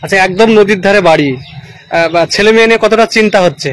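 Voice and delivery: calling out to be heard; loud. Real, not acted. Reporter asking villager on site. (জোরে প্রশ্ন)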